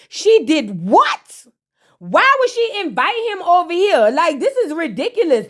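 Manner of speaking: high, stretched mock indignation; outraged disbelief. Sister hears who was invited, acted outraged disbelief. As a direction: act out a scandalized reaction, stretching the question. Acts out Flo's outraged reaction.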